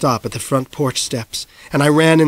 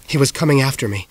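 plain reading voice

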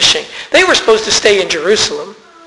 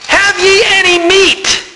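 high, slow call across the water; 9 semitones higher, slower than usual. Retold or imitated. Jesus on the shore calls out to the fishermen. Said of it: "And he yells out to them".

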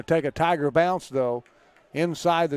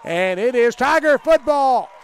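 excited call; 5 semitones higher, 5 dB louder than usual. Real, not acted. Tigers take the ball away.

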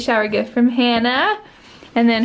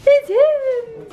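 high excited announcement; 10 semitones higher than usual. Real, not acted. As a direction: burst out happily. Dad arriving home.

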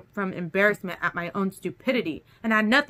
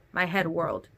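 ordinary delivery, nothing marked

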